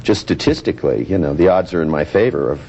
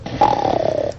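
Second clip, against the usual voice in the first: very low growling noise; inner-voice noise; 14 semitones lower than usual. Acted. Therapist demonstrates his own inner voice, low growl. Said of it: "a little voice inside my head that goes".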